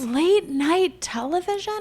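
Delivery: high, outraged Late night television?; mock incredulous. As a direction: feign shock at the news. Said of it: "Late night T V is over".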